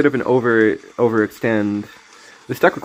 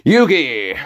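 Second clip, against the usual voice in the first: lightly voiced rival calling out; a hint of an anime duelist; Kaiba impression; 3 semitones higher than usual. Calling his rival's name as Kaiba, lightly voiced. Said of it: "To invoke Kaiba".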